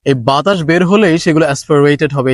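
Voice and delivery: ordinary talk, nothing marked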